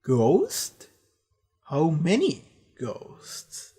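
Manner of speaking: slow, drawn-out villain voice, with gaps between phrases; attempted impression of a deep, menacing antagonist; intimidating Fisher King. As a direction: stretch each word out like an ominous monster. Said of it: "like, very deep and intimidating".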